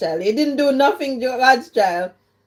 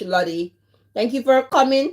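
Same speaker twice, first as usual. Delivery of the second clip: usual speaking voice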